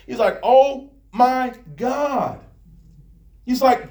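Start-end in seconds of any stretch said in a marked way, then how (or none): 0.4-2.4 slow, drawn-out exasperation; an overwhelmed man's drawn-out complaint to God; exasperated Moses. Acted. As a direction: draw out every word in exasperated disbelief. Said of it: "and I'm angry".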